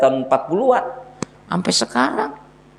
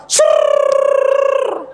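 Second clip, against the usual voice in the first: imitated hose spray; 3 dB louder than usual.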